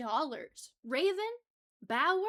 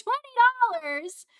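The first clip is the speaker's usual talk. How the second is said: slow, high, loud delighted shout; excited cry; 9 semitones higher, 13 dB louder, slower than usual. Real, not acted. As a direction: drag out the joy. Repeating the donation, thrilled.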